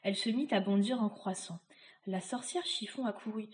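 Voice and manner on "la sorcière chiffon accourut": ordinary delivery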